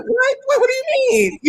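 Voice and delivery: high, shocked squeak of disbelief. Retold or imitated. Imitating her reaction to someone estranged from mother, high shocked what. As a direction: act stunned, voice jumping way up. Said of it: "it's like".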